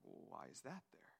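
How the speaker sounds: susurro desconcertado, muy bajo; whisper. Retold or imitated. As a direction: preguntando en un susurro, intrigado. Listener puzzled by a detail.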